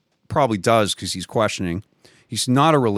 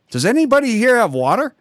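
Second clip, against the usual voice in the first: calling out; 12 semitones higher, 4 dB louder than usual. Retold or imitated. Asking crowd for water.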